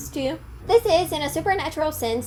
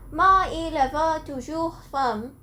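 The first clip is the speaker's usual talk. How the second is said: slow, careful amateur French, a little higher; a vlogger trying the possessed girl's French lines, slow and careful; attempted French; 4 semitones higher than usual. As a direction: sound out the French with a learner's caution. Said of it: "see my attempt".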